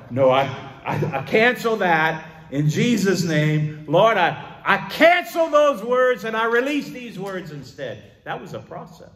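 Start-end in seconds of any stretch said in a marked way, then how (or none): 0.1-8.0 loud, high, emphatic prayer cry; forceful declaration. Acted. Believer revoking their own words in prayer, forceful declaration. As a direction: cancel it out loud, high and urgent. Praying aloud to cancel words.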